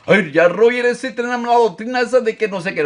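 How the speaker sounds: high, whiny mock complaint; mocking critic. Preacher mimicking a churchgoer who accuses him of odd doctrine, whiny. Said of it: "para que no usted me confunda".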